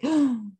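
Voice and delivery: mock gasp. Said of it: "My girls be like".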